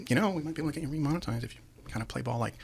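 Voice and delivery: softly imitated executive's offer; discreet hinting. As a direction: drop your voice for a retold backroom hint. Retelling executives' behind-the-scenes offer.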